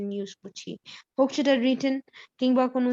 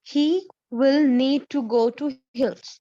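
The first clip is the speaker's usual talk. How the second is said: very slow, louder, word by word; slow dictation; 5 dB louder, much slower than usual. Shown as a demonstration. Teacher giving the class a spelling test sentence, slow dictation. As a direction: dictate it slowly for learners to write. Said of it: "সেন্টেন্সটা হচ্ছে এরকম".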